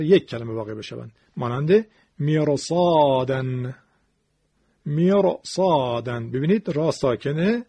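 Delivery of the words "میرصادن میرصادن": slow recitation of میرصادن with thick R; heavy R. Shown as a demonstration. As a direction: draw out میرصادن, the R heavy before the ص. (میرصادن کشیده و با تفخیم راء)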